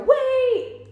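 loud high cry to stop them; calling out. Retold or imitated. A voice stopping the execution, shouting. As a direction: shout it to halt everything.

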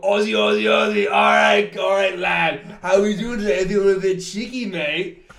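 loud, high, hammed-up Australian accent, shouted. Mocking the Australian groper in Nashville with a hammed-up Australian accent. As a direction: bellow it like an over-the-top Aussie cliché. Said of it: "the most offensive Australian accent".

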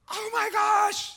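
slow, high mock-panicked shout; hammed-up shocked dad, loud and high. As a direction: act out a father freaking out at the wreck. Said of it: "He starts freaking out".

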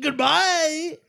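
high, posh British farewell; British accent. Pretend. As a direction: sign off like a prim Brit. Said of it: "Oh, I'm going British".

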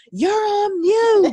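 high, slow, loud call; loud mock call. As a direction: call out over a bad call. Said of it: "You like".

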